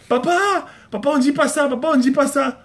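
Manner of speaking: put-on high child calling Dad out; imitated daughter. Daughter shaming papa in front of people, imitated child protest. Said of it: "Tu es là devant les gens".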